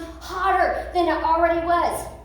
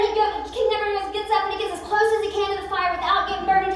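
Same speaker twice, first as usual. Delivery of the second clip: ordinary delivery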